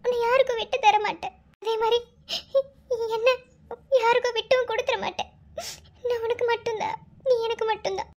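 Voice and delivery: high, crying promise; tearful vow. Acted. Girl vowing to her lover she won't let him go, crying.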